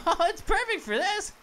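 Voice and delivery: usual speaking voice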